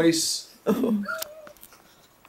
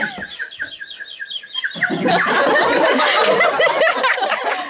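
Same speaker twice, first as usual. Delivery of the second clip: giggling laugh. Real, not acted. Giggling through the story.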